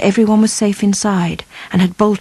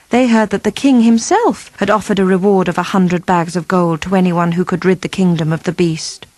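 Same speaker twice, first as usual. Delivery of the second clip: ordinary delivery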